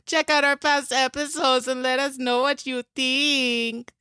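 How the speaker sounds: little creature earnestly reading, stretching the last words; Mort. Acted. Podcast host thanks listeners in the outro, Mort impression. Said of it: "the best Mort impression I've ever heard".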